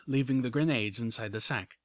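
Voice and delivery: plain reading voice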